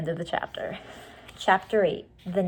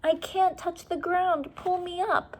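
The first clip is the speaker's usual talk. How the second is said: calling up; 11 semitones higher than usual. A narrator's reading. Jack hanging from the ledge calling to Annie. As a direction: call up for help, scared. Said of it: "he called to Annie".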